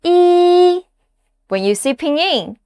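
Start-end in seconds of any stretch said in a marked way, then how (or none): 0.0-0.9 "Yi." held on one high, level note; steady high-pitched "Yi."; high flat tone. Shown as a demonstration. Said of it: "The first tone is high and flat".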